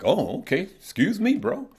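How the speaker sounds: high-pitched retold "excuse me"; mock apology. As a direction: act out a taken-aback reply, pitch climbing. Acts out reply to being corrected.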